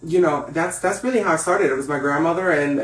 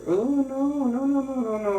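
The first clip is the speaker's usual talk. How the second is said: horrified refusal; 9 semitones higher, 3 dB quieter than usual. Retold or imitated. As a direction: recoil in mock horror, high and wailing. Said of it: "one of the gays that was like".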